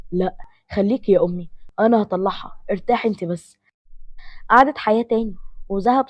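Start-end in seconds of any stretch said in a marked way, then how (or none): none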